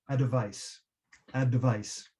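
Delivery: a tiny vowel between d and v, "a device"; vowel inserted. Shown as a demonstration. Teacher showing learners how to split the dv cluster. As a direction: break the cluster: "a device" with a schwa after d. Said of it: "breaking up consonant clusters with a vowel".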